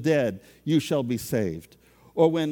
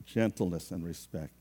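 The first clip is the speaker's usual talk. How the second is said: softer and lower, gently; quiet gentle repeat; 7 semitones lower, 6 dB quieter than usual. Shown as a demonstration. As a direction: model a gentle tone. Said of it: "I gotta watch my tone".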